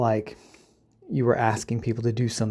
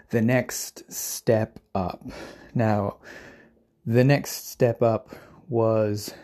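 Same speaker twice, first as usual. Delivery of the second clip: normal talking voice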